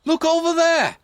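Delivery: high, loud urgent wake-up call; shout. Acted. Constable shouting at the sleepy sergeant, high and loud. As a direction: yell to rouse a dozing sergeant. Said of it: "he shouted".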